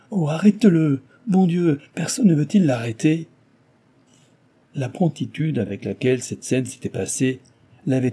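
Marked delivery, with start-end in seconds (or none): 0.1-3.3 high, loud frightened plea; panicked cry. A narrator's reading. Cecilia terrified, crying for someone to stop the chevalier. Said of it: "s'écria".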